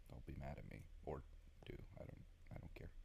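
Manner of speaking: breathy throwaway whisper. Real, not acted. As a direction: drop it to a whispered aside. Throwaway aside to viewers.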